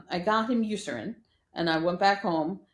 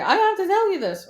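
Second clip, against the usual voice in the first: mock-eager, much higher and louder; eager customer; 12 semitones higher, 8 dB louder than usual. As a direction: play her bursting to share news. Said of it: "She was like".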